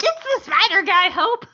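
excited. Retold or imitated. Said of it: "you've always just like".

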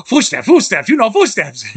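light imitated German accent on footsteps; inspector's German accent, mildly imitated. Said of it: "whose German accent is so thick".

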